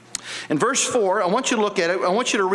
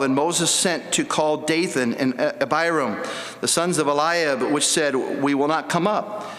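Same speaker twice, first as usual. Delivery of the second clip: lower, measured King James reading voice; scripture reading; 5 semitones lower than usual. Acted. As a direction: read the verses out like holy writ. Preacher reads scripture aloud.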